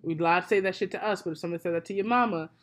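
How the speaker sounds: low, soft, controlled telling-off; stern reproach. Real, not acted. Guest confronting co-host over double standard, low and stern. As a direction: drop the voice and lay it out seriously.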